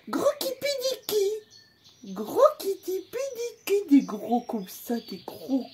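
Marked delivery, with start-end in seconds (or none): 0.1-3.9 put-on high, slow sing-song baby talk; silly baby talk. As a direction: stretch the silly pet name. Silly pet-name baby talk.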